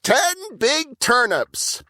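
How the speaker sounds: high, slow and proud; high, slow Burple; proud little brother. A narrator's reading. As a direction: present your gift like a proud kid. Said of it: "Burple said".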